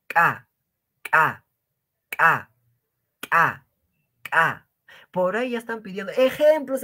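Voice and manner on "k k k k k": K alone popped sharply and louder; explosive k. Shown as a demonstration. Teacher drilling the ejective K, sharp. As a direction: snap each K out like a burst. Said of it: "como si estarían haciendo reventar o explotar algo".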